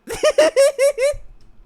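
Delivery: real loud squealing laugh. Real, not acted. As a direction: shriek with laughter. Laughing fit.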